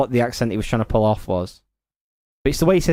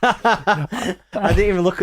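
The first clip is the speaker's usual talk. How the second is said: slow words broken by real laughter; laughing while talking; 7 semitones higher, much slower than usual. Real, not acted. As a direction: get it out through the giggles. Laughing at the pun headline.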